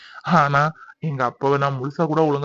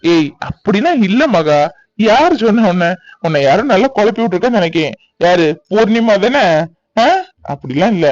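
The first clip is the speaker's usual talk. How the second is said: loud protesting reassurance; 3 semitones higher, 5 dB louder than usual. Acted. Husband loudly denies wife's worry.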